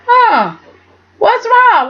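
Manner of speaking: high, mock-concerned huh; playful high-pitched prodding; teasing high squeak. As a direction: tease her with a high little squeal. Mock teasing Chelsea in retelling.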